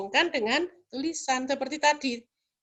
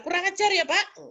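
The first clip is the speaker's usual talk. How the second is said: a touch higher and sharper, imitating a rude stranger; mock angry scolding; 6 semitones higher than usual. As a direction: play the stranger snapping back after being bumped. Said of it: "marah pada kita, misalnya memaki kita". (makian marah yang ditirukan)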